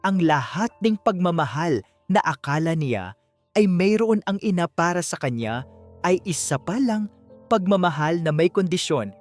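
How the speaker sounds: ordinary delivery, nothing marked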